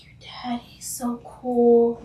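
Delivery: shy sister confiding about dad, whispered; whispered awe. Retold or imitated. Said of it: "she was like".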